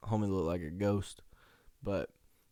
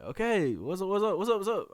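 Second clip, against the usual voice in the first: high, rising rapid-fire reply; acted-out flustered scramble; flustered response; 9 semitones higher than usual. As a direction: answer in a high, hurried babble. Responding to dad screaming his name.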